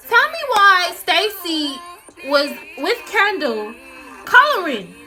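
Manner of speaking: loud, slow, indignant; outraged. Retold or imitated. Telling how she caught Stacy coloring with Kendall, loud outrage. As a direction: dwell on each word, scandalised.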